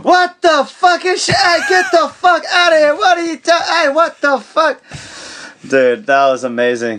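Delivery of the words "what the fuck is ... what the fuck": high, loud furious yelling at intruders; startled rage, voice cracking high; angry yelling. Retold or imitated. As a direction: scream at the kids waking you up. Woken Smolik yelling at kids.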